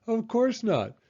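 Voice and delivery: high-pitched amused dismissal; giggling. A narrator's reading. Beaver dismisses Franklin's idea of live dinosaurs, giggling. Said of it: "giggled Beaver".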